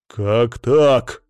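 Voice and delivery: loud, outraged question; enraged. A narrator's reading. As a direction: burst out in indignation. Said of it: "тут же взбеленился Колян".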